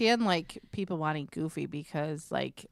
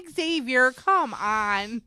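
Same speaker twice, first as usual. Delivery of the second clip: louder, higher exasperated call; exasperated scolding; 6 semitones higher, 10 dB louder, much slower than usual. Retold or imitated. Mom scolding her son for saying piss, exasperated. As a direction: call him out, fed up.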